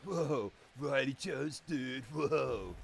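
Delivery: slow, drawn-out surfer dude; laid-back dude voice. Pretend. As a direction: stretch the whoa like a stoked surfer. Hypes wrestler in dude voice.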